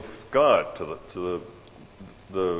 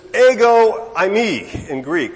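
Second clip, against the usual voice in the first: "ego eimi" loud, high and drawn out; emphatic stress; 9 semitones higher, 10 dB louder, slower than usual. Shown as a demonstration. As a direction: punch out "ego eimi" slowly and forcefully. Stressing the emphatic Greek form.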